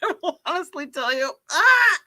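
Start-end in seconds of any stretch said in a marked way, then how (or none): 1.5-2.0 loud high yelp; sharp yelp. Real, not acted. Freaked out by psychics, a loud high yelp. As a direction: let out a sudden high cry. Startled outburst about psychics.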